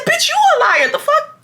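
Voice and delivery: very high-pitched indignant retort; hammed-up squeal of outrage; outraged comeback. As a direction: throw the accusation right back. Firing the accusation back.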